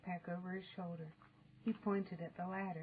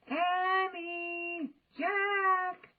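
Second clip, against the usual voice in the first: loud high call from far; 10 semitones higher, 6 dB louder, much slower than usual. A narrator's reading. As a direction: call the children home. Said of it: "she called".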